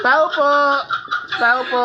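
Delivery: loud high call at a gate; calling from far away. Real, not acted. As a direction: shout it toward a distant house.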